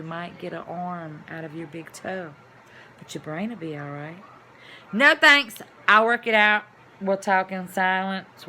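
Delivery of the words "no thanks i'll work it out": loud, high no thanks; firm refusal. Real, not acted. Refusing the medication, loud and firm. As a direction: turn the pills down flatly.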